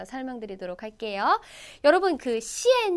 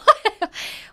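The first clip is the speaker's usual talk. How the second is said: loud bright laugh; burst of laughter; 8 semitones higher, 7 dB louder than usual. Real, not acted. 비 오는 날씨 좋아한다며 크게 웃는 선생님. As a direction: laugh out at her own remark. Teacher laughing at own remark.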